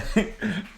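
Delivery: nervous high giggle; shaky relieved laugh; giggle after scare. Real, not acted. Laughing after being startled.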